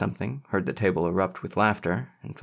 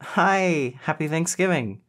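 high-pitched, cheery hello; joyful greeting; 8 semitones higher, slower than usual. A narrator's reading. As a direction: greet a dear friend with delight. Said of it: "she exclaimed joyfully".

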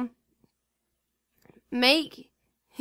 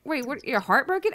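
ordinary delivery

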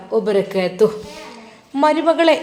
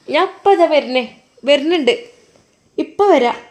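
raised, higher call back to the house; 5 semitones higher than usual. Acted. Mother-in-law calling out to her daughter-in-law, raised and higher. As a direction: shout that she's coming. Mother answers hurriedly from afar.

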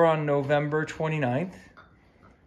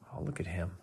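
soft whisper, fond and lower; whispered coo; 10 semitones lower, 14 dB quieter, faster than usual. Real, not acted. Owner cooing over her happy dog, whispered. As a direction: whisper it like he might hear.

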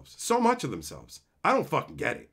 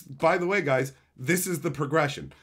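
usual speaking voice

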